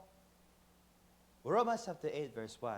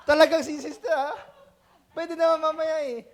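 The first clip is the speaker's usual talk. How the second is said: louder, higher, teasing through laughter; laughing tease; 7 semitones higher, 9 dB louder than usual. Real, not acted. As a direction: tease her while laughing. (tumatawang pang-aasar)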